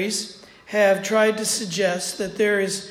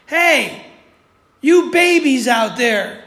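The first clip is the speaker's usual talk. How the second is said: loud, higher scolding call; 4 semitones higher, 8 dB louder, slower than usual. Acted. Jesus calling to the disciples on the boat, loud scolding. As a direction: call them babies loud, to shame them.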